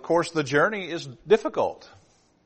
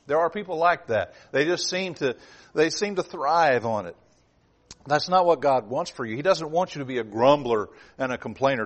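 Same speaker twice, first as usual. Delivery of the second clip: ordinary talk, nothing marked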